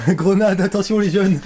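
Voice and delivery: high, loud, laughing call; laughing warning. Acted. Repeated warning while laughing. (avertissement en riant)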